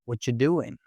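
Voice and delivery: "What you doing" blended into "whatcha doing"; casual linked "What you doing", the "are" swallowed; connected speech. Shown as a demonstration. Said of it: "sounds like".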